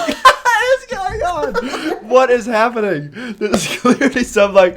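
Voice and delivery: high, incredulous, barely getting words out; laughing hard, words stretched; helpless laughter. Real, not acted. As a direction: cry out in disbelief while laughing. Cracking up at confused review.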